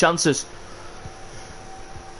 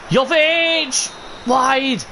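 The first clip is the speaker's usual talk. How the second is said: excited shout; 8 semitones higher, much slower than usual. Real, not acted. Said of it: "I really do apologise for shouting".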